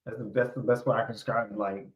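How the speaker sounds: normal talking voice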